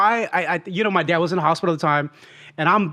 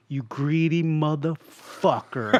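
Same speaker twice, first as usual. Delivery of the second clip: slow, low deliberate insult; measured, drawn-out mock contempt; imitated insult; 3 semitones lower, 4 dB quieter, much slower than usual. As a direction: spell it out slowly, word by word. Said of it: "this is what exactly you said".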